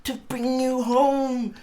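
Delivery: put-on high, slow, singsong child's voice; a child bubbling with delight; gleeful little girl. A narrator's reading. Little sister coaxing her brother home, high gleeful voice. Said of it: "clapping her tiny hands and bending down to laugh".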